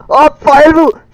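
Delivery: loud, high, drawn-out outburst; frustrated shout. Real, not acted. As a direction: shout the order in exasperation. Frustrated shouted directions to teammate in game. (frustreret råb)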